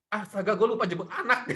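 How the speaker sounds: high, loud sudden panic; startled gasp of a forgetful parent; mock panic. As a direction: play the parent who just remembered.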